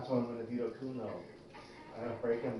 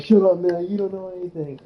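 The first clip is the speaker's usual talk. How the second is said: higher, louder, hammed-up angry shout; mock angry outburst; 9 semitones higher, 15 dB louder than usual. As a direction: snap at him like a fed-up kid who lashes out. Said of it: "He's like".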